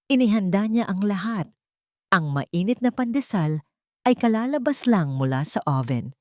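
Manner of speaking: ordinary delivery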